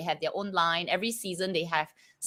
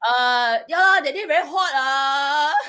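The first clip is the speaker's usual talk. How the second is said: put-on Singaporean accent; 5 semitones higher, 4 dB louder than usual. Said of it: "You talk like this".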